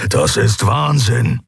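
slow, rumbling protest; growling. Acted. Keru growling at the Shayang, slow. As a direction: grumble it out, slow and heavy. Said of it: "grollte Keru".

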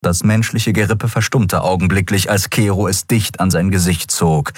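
usual speaking voice

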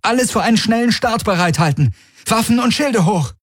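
high-pitched, pressing command; urgent called orders. A narrator's reading. Kirai orders the ship readied for launch, urgent. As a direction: call out orders for a quick launch. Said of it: "rief Kirai in ihr Kommen".

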